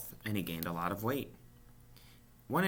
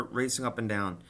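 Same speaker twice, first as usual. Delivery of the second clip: plain reading voice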